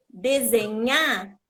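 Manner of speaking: desenhar as "desenhá", slow and loud, final NHA stressed; leitura sem R. Shown as a demonstration. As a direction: read desenhar without R but keep the end strong. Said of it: "mesmo sem o R". ("desenhar" sem R, com NHÁ forte no final)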